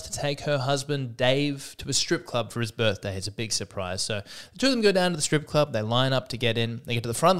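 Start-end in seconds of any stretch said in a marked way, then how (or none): none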